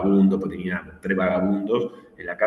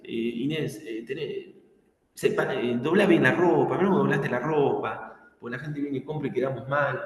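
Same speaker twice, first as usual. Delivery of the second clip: mild imitation of a nagging boss, higher; lightly voiced scolding boss; retando a la empleada; 6 semitones higher than usual. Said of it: "le decía". (jefe que reta a Inés por doblar mal la ropa, regaño leve)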